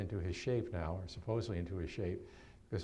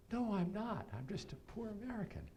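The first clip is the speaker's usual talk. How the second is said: much higher, softer, hammed-up poor student; mock pleading; 8 semitones higher, 6 dB quieter than usual. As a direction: plead innocence in a high mock whine.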